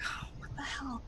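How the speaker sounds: breathless whispered shock; whispered disbelief; startled whisper. Acted. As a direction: gasp it under your breath. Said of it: "And I'm like".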